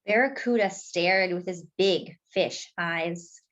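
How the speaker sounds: ordinary delivery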